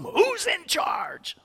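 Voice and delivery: high, slow mock-indignant shout; demanding lady. Neighbor lady storming into the church, demanding shout. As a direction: bark it like an offended neighbor storming in.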